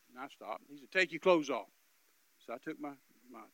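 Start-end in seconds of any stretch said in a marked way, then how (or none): 0.9-1.7 gruff order. Retold or imitated. Robber orders.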